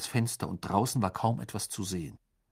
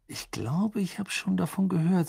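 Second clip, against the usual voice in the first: higher, hazy recollection; vague; 8 semitones higher than usual. A narrator's reading. Ron recalling Durmstrang to his friends, vaguely. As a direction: grope vaguely for a memory. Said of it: "sagte Ron verschwommen".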